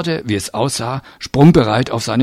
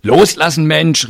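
higher, louder protest; called out; 6 semitones higher, 4 dB louder than usual. A narrator's reading. Man grabbed by the collar, calling out. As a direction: call out to be let go. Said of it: "rief ich".

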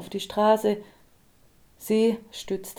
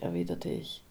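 ordinary delivery

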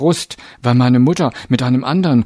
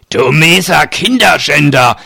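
loud, high, furious accusation; angry snarl at someone; 5 semitones higher, 7 dB louder than usual. A narrator's reading. Giant collaring a supposed child abuser, barking angrily. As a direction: lash out with loud contempt. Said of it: "herrschte er mich an".

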